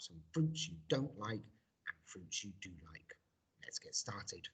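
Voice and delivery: soft unvoiced whisper; hushed teacher aside. Real, not acted. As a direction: murmur it under the breath. Whispered intro lines.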